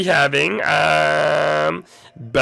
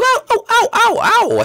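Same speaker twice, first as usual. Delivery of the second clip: pained yelps. Pretend. Shot by arrow on tightrope.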